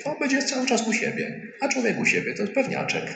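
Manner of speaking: high, cheeky tone; playful aside. Retold or imitated. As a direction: tease about feeling at home. Voicing Jesus, playful. (wysoki, żartobliwy ton)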